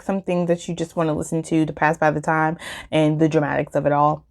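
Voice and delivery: ordinary talk, nothing marked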